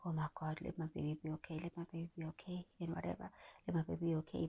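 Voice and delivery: soft, pleading whispered prayer. Retold or imitated. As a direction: hush it like a desperate prayer. Praying during contractions, retold.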